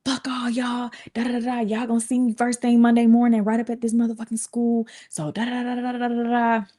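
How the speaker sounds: high, scornful mock tirade; mom cussing out. Mother cursing the coaches on her way out, high scornful tirade. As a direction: fire off insults like mom, pitched high. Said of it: "My mom was just like".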